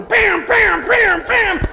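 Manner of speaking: high, loud yelled bams. Retold or imitated. Man roaring while pretending to beat the armadillo, loud high yells. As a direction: belt out each bam like a wild attack.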